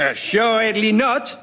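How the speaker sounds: put-on slow, emphatic French accent; outrageous French accent. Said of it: "outrageous French accent".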